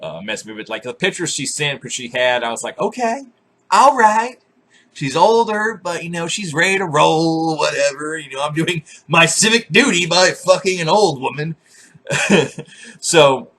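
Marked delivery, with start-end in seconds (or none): 2.8-11.6 higher, jokey rationalizing inner voice; mock self-talk. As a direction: convince yourself with mock nobility. Inner voice justifying Tinder date.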